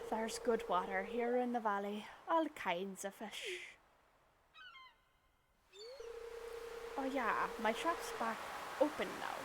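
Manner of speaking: lightly voiced pirate shopkeeper pitching his shop; a hint of a cheerful sea dog with a catchphrase; Willy pirate voice. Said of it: "I'm gonna give him a pirate voice".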